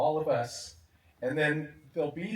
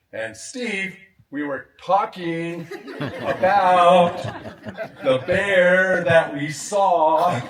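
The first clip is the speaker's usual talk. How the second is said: slow, over-loud and higher, laughing through it; loud for deaf ear; 6 semitones higher, 12 dB louder, much slower than usual. Retold or imitated. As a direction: spell it out loudly and slowly to a hard-of-hearing friend. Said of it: "he would do it like".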